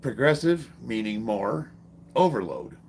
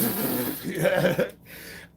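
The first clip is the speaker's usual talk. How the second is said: helpless giggle; 9 semitones higher than usual. Retold or imitated. Laughing during flutter kicks.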